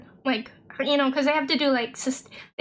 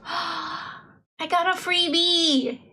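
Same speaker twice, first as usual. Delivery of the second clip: gasping, drawn-out glee; excited delight; 5 semitones higher, much slower than usual. Real, not acted. Player just given a free bee, gasping excitement. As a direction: draw it out slowly in gleeful surprise.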